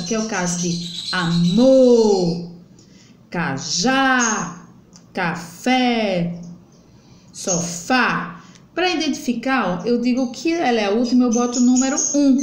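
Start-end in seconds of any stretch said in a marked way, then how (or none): 1.1-2.5 "amor" with a long, loud MOR; stressed last syllable. Shown as a demonstration. Teacher showing an oxytone, "amor" stressed hard on MOR. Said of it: "ela falar com mais força". 3.3-8.4 "cajá", "café", "sofá" with lengthened final syllables; stressed last syllable. Shown as a demonstration. Teacher listing oxytones, "cajá, café, sofá" stressed on the last syllable. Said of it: "ela falar com mais força".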